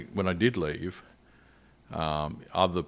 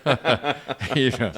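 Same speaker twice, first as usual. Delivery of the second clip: breaks into a real laugh, a bit louder and higher; 7 semitones higher, 7 dB louder, slower than usual. Real, not acted. To the interviewer, laughing at his own confession. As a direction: laugh it off, sheepish about binning letters.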